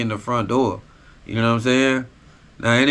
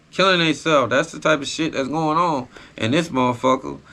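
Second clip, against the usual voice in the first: normal talking voice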